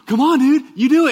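put-on boy calling his friend to jump; excited kid shout. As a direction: yell it like a thrilled kid on the treetops. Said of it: "He goes, gasps".

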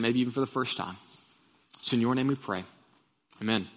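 reverent pastor's prayer. Real, not acted. Opening prayer.

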